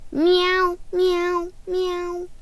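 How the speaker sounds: soft playful meowing, fading away; cat meows. Pretend. Pretending to be a cat.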